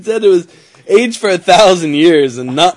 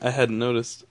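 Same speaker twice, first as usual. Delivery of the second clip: sarcastic; 4 semitones lower, 8 dB quieter than usual. Acted. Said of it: "That's sarcasm".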